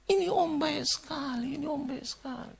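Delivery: high, drawn-out mock weeping; retold sobbing lament, pitched high; tearful, imitated. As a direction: imitate a mourner wailing slowly through tears. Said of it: "Dia ucapkan itu berulang-ulang".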